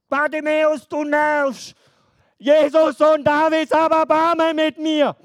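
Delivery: high shouted clash of voices; loud shouting. Acted.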